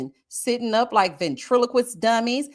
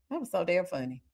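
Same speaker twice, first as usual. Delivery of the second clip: normal talking voice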